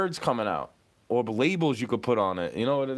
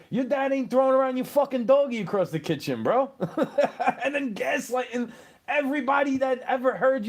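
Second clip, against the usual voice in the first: heated rant; 8 semitones higher than usual. Real, not acted. Venting about abusive dad.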